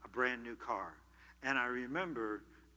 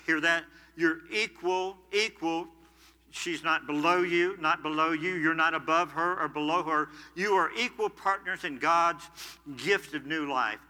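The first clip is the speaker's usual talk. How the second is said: loud, rising preacher's emphasis, pressing each word; preaching at full force; 3 semitones higher, 5 dB louder than usual. Acted. Pastor urging husbands, preaching hard and loud. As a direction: drive home equal partners to the church.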